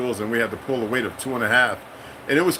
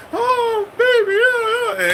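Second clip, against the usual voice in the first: very high, slow wailing cry of joy; overjoyed crying out; 5 dB louder, much slower than usual. Retold or imitated. Grandmama greeting Ray on the street, high tearful wail. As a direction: cry out as if overcome seeing him.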